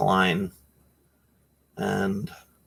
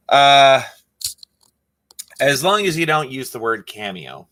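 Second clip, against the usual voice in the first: ordinary delivery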